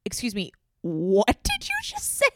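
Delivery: hammed-up "come again?" shock; mock disbelief. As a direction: act out being taken aback. Acted shocked reply to friend.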